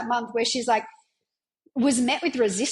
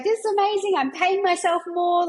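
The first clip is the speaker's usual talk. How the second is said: high, bright-eyed squeal of delight; thrilled client; 7 semitones higher, 3 dB louder than usual. Retold or imitated. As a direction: gush like she's just been paid. Client thrilled about paying herself.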